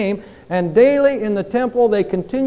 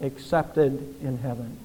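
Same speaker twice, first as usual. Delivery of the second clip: "accepted in heaven" read slowly and softer; hushed solemn reading; 7 semitones lower, 9 dB quieter, slower than usual. Shown as a demonstration. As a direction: let "accepted in heaven" fall soft and slow. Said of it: "oh, that we could print this in our minds".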